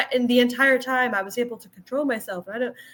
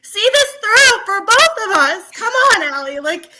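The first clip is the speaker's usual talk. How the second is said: higher and louder, laughing plea; excited mock plea; 8 semitones higher, 5 dB louder than usual. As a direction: beg the character, half laughing. Pleading with character, laughing.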